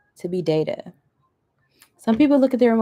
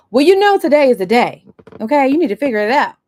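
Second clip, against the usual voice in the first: higher and louder, impatient scolding; harsh boss; 5 semitones higher, 10 dB louder, faster than usual. Retold or imitated. Boss scolding a struggling employee on a big day, impatient. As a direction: snap at the employee to sort it out.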